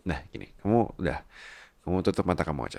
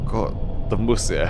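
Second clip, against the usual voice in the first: normal talking voice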